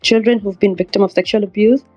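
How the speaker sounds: ordinary talk, nothing marked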